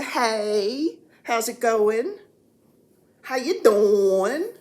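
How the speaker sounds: drawn-out Baltimore greeting; Baltimore accent. Shown as a demonstration. Vlogger inviting viewers to email hello, Baltimore accent. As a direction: greet them in broad Baltimore vowels. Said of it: "Baltimore accent".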